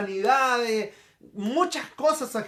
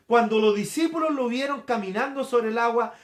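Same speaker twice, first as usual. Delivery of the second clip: ordinary talk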